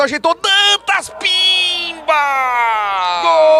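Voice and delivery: shouted, stretched-out goal call; explosive goal commentary. Real, not acted. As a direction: roar it out as the ball goes in. Live goal call, scoring play.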